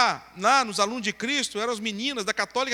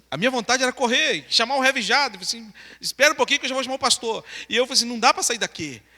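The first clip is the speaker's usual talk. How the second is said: loud, higher, agitated storytelling; panicked retelling; 3 semitones higher, 6 dB louder, faster than usual. Retold or imitated. Teen wanting to flee and fetch the pastor, panicked retelling. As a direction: retell the urge to run, voice raised.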